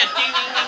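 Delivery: high, loud imitated clanging; imitation of a cup on cell bars; rattling cup noise. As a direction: rattle out dings like tin on bars. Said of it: "rattling his cup on the bars".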